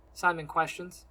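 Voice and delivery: plain reading voice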